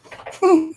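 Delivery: short high burst of laughter. Real, not acted. Laugh heard.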